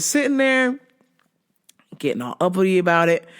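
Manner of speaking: ordinary talk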